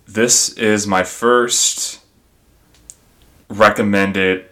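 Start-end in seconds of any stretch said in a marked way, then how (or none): none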